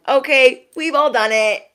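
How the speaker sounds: normal talking voice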